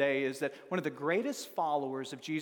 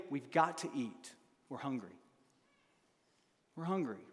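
weary hungry; 4 semitones lower, 7 dB quieter than usual. Acted. Disciples voiced as hungry.

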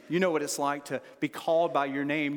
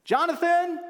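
Jonathan yelled much higher and louder, stretched out; loud, high call; 14 semitones higher, 6 dB louder than usual. Shown as a demonstration. Father hollers for his son, high and loud. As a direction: shout Jonathan across the yard. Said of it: "told through me calling their names".

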